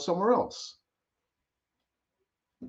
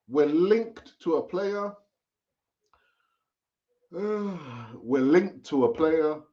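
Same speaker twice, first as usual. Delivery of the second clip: weary drawn-out; much slower than usual. Real, not acted. Slowing, weary repetition.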